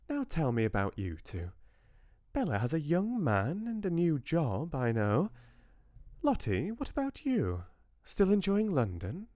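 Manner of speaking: an elegant Southern lady catching up with her granddaughters; lightly voiced Southern grandmother. Georgia voiced through dialogue.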